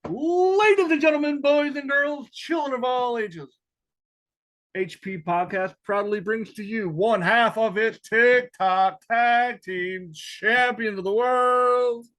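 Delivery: booming circus announcer intro, slowing at the end; ringmaster. Acted. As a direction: announce it like a big top ringmaster.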